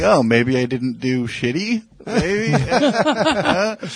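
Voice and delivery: mock-hopeful, rising into a high questioning "maybe"; tentative hope. Comedian retelling his doubts after a set, tentatively hopeful.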